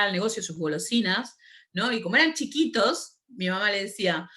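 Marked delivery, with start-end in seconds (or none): none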